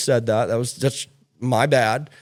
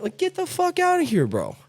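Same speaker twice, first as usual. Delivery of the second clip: much higher, incredulous get outta here; scoffing dismissal; 13 semitones higher than usual. Retold or imitated. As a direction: toss it off with a high scoff. Dismissing beggars, mock scoff.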